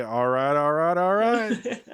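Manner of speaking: slow, drawn-out catchphrase with a rising pitch; Matthew McConaughey. Acted. Suggesting a new wake word for the speaker, celebrity catchphrase impression. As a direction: stretch the repeats out, amused. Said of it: "I'm surprised yours isn't Matthew McConaughey".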